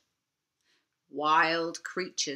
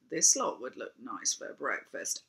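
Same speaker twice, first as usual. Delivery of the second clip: lower, menacing dragon voice; a hungry dragon eyeing its breakfast; greedy dragon; 8 semitones lower, 4 dB quieter than usual. Acted. Dragon eyes them hungrily.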